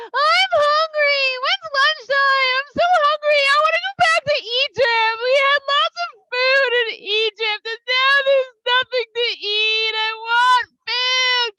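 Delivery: high, loud, drawn-out whining; whiny hungry crowd. Acted. The hungry people whine to Moshe for food. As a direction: wail like starving kids begging for food.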